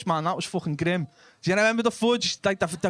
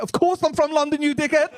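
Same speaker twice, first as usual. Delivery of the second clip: high, hammed-up London accent; fake London accent; 10 semitones higher, 4 dB louder than usual. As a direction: blurt it out as a put-on Londoner. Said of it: "I'm chatting shit".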